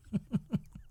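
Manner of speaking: high, soft chuckle; short laugh. Real, not acted. Comic laughing at her absurd answer, chuckling. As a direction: let out a few quiet high pulses of laughter.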